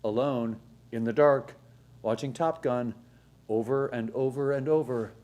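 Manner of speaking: drawn-out number, quick deadpan item; countdown host. Acted. Host counting down summer jobs, game-show announcer. Countdown list read over music.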